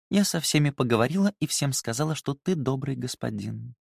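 sleepy mumble. A narrator's reading. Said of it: "сонно пробормотала она".

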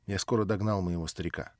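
ordinary delivery

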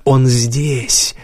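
urgent half-whisper, broken by pauses; hot loud whisper. A narrator's reading. Woman pointing out the dragon everywhere, hot half-whisper. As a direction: breathe each word out hotly, place by place. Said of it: "прошептала она горячо и громко".

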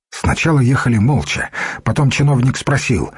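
usual speaking voice

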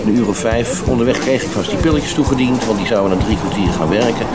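usual speaking voice

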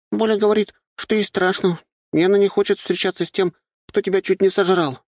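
very high-pitched little sister calling over; Nyusha calling out. A narrator's reading. Little sister calls to brother from upwind, high girlish call. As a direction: pipe up from afar in a high girl's voice. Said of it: "с приличного расстояния и подветренной стороны выкрикнула Нюша".

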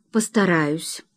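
usual speaking voice

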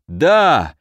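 short, forceful rallying shout; roaring guards. A narrator's reading. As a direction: cry it out like a rallied troop. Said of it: "заревела стража". (короткий боевой клич стражи)

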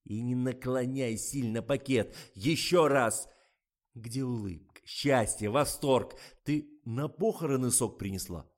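loud, impatient scolding; exasperated director's shouting; barked directing. A narrator's reading. Director berates actress during take.

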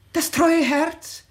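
normal talking voice

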